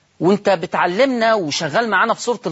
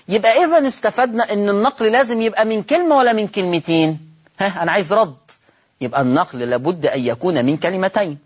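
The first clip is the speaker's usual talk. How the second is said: normal talking voice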